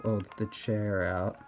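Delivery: ordinary talk, nothing marked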